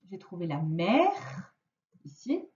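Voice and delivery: ordinary talk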